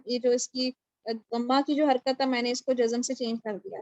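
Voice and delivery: normal talking voice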